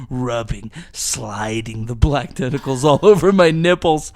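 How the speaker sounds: loud, hammed-up erotic story read; overacted melodramatic narration; dramatic reading. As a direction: perform the fanfic over the top. Reads erotic fanfic aloud.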